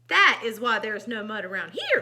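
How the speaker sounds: loud, emphatic complaint; storybook outburst; indignant cry. A narrator's reading. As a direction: cry out as the small pig. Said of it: "cries the small pig".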